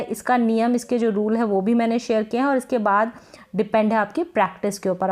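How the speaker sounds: ordinary talk, nothing marked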